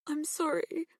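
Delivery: a touch of tears; tearful. A narrator's reading. Girl apologising to her parents, mildly tearful.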